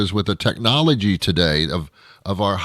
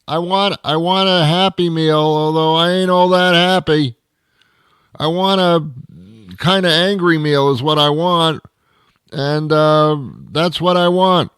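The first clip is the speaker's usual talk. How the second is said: higher, raised voice ordering into the speaker; fed-up driver ordering into a crackly drive-through speaker, raised voice; grumpy customer ordering; 5 semitones higher than usual. Acted.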